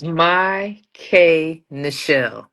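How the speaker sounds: "My K Nichelle" slowly, as three separate parts; correct name pronunciation. Shown as a demonstration. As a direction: spell out the sound: "My K Nichelle", K said "kay". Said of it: "how did you pronounce".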